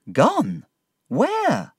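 high-pitched, disbelieving questions; shocked cry. A narrator's reading. Narrator learns Emily is gone, cries out in shock. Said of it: "I cried".

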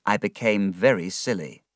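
plain reading voice

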